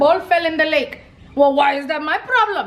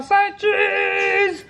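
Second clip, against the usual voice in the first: drawn-out, higher "cheese" for the camera; photo-time cheese; 4 semitones higher than usual. Acted. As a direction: stretch out "cheese" like posing for a picture. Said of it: "do the people say when you take a picture".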